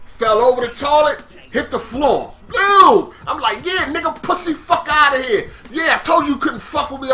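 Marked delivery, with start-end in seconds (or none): 2.5-3.1 loud, high burst of sound; imitated impact noise. Storyteller voicing the man hitting the floor, loud imitated impact. 3.6-7.1 higher-pitched taunting shout; re-enacted trash talk. Winner of the fight taunting his downed cellmate, worked-up trash talk.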